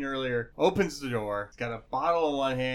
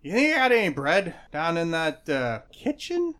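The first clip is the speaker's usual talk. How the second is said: tipsy gnome; 5 semitones higher, 7 dB louder than usual. Acted. Drunk gnome asks for bread.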